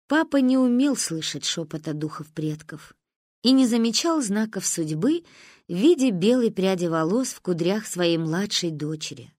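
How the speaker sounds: ordinary delivery, nothing marked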